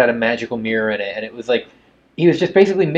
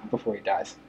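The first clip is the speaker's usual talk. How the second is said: usual speaking voice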